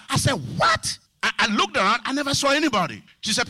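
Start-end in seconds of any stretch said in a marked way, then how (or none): 0.6-1.0 high-pitched startled "What?"; incredulous, shooting up high; shocked what. Retold or imitated. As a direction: yelp it in disbelief. Retold shock at her claim.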